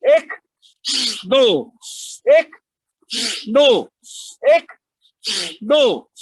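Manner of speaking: loud breath count. Real, not acted. Yoga teacher counting strokes for the class, loud rhythmic call. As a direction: call each number out like a coach.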